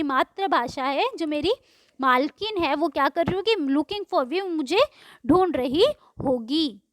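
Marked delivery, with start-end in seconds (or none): none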